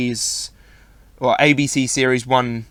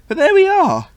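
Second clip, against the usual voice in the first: voice cracking; 5 dB louder than usual. Real, not acted. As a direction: voice breaks up high mid-phrase. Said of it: "Lovely voice break there".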